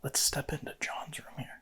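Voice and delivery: hushed unvoiced whisper. Real, not acted. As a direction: breathe it without voice. Quiet ghost hunt in house.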